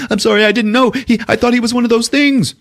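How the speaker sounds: high, frantic apology; panicked high voice. Retold or imitated. Podcaster voicing a scared crewman, panicky. Retelling movie, panicked character.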